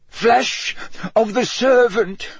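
high, broken, sobbing incantation; petrified sobbing. A narrator's reading. Wormtail whimpering over the dagger as he chants, high petrified sobs. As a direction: force the spell out through terrified sobs. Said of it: "His voice broke into petrified sobs".

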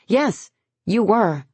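high, slow accusation; accusing. A narrator's reading. Lauren accusing Henry of staring, high and slow. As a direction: call him out on it. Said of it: "Lauren accuses him".

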